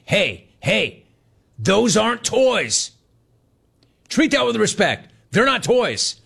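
a hint of a strict father scolding; imitating dad. Dad scolding his son over the screwdrivers, mock stern. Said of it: "my dad would say to me".

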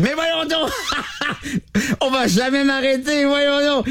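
very high voice, laughing through it; laughing Momo. Retold or imitated. Momo laughs at the host's fear of police, very high.

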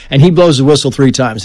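ordinary delivery

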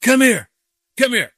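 retold summons. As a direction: call someone over as the intimidating man. Retold man calling him over.

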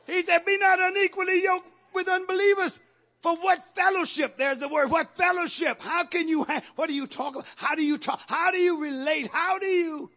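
high, loud preacher's cry; full-force preaching. Real, not acted. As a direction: hammer out each question to the congregation.

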